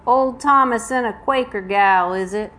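hammed-up Southern accent for a story villain. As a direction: play a needling Southern man. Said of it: "you will hear my Southern accent".

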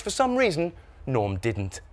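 usual speaking voice

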